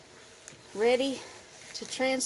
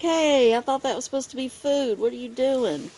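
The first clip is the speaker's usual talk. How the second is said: raised, louder indignant spider voice; voicing the spider; 5 semitones higher, 6 dB louder than usual. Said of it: "She's like".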